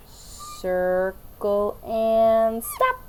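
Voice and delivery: drawn-out, slow circle and stop; slow teacher demo. Shown as a demonstration. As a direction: stretch it out as you draw. Teacher demos letter stroke.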